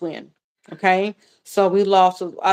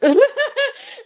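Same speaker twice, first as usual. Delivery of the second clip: embarrassed laugh; 3 dB louder than usual. Real, not acted. Laughing off the tears.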